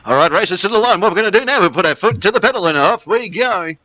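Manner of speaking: loud, high ride-caller spiel; carny voice. Shown as a demonstration. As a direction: belt it out like a dodgem-car operator. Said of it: "the Dodgem car voice".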